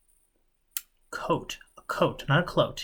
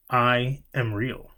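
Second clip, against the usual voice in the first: slow, every word weighted and separate; deliberate, word by word; each word emphasized; slower than usual. A narrator's reading. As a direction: press on each word of "I am real". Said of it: "emphasized each of the words as he spoke them".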